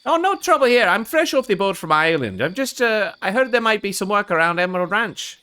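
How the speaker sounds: bad, put-on Irish accent; Barnaby Gallows. Newcomer asking about work at the ranch, bad Irish accent. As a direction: play a friendly fake Irishman. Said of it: "my bad Irish accent sound?".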